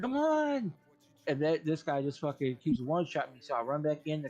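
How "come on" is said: high, drawn-out exasperated whine; retold exasperation. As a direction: re-enact a frustrated plea, pitched way up. Repeats plea, more frustrated.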